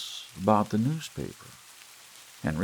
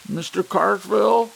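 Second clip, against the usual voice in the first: high, loud cracking call; desperate cracking shout; cracked hoarse call; 13 semitones higher, 11 dB louder than usual. A narrator's reading. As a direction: call out, voice breaking. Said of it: "his voice cracked hoarsely".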